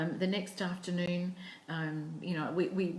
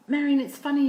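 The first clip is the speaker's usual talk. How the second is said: much higher, louder opening; a confiding girl, higher and louder; retold eager confiding; 9 semitones higher, 10 dB louder than usual. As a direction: blurt it out eagerly.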